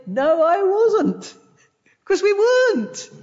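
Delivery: a hint of an indignant churchgoer; mock objector. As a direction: play the doubter answering back. Voicing imagined listener's objection.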